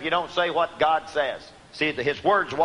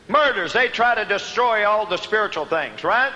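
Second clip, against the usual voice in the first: higher, emphatic preaching voice; raised preaching; 4 semitones higher than usual. Real, not acted. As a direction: preach it out to the congregation. Preaches on scripture at raised pitch.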